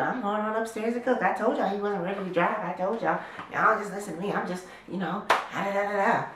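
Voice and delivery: breezy, smug mom heading upstairs. Retold or imitated. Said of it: "she's in a good mood".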